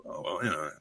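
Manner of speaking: sheepish, halting low mumble; bashful orc. Acted. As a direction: confess it reluctantly under your breath. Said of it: "looking down bashfully and shit".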